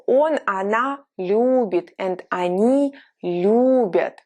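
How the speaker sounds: "я люблю" drawn out, extra "л" clear; slow, clear conjugation drill. Shown as a demonstration. Tutor drills students on "любить", slow and clear. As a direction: model each form slowly for learners. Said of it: "show us the consonant interchange".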